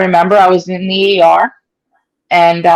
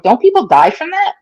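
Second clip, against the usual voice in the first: much higher, worried; alarmed question; 9 semitones higher than usual. Retold or imitated. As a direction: ask in disbelief and fear. Hearing the diagnosis.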